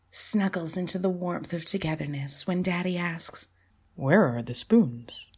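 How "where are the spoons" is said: dad voice. Acted. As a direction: lower puzzled dad question. Daddy asks, lower voice.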